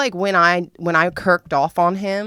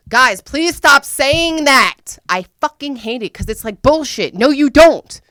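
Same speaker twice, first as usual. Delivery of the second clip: loud and high, half laughing in protest; exasperated outburst; 8 semitones higher, 8 dB louder, slower than usual. Real, not acted. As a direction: shout them down, you hate that line. Fed-up rant to the audience.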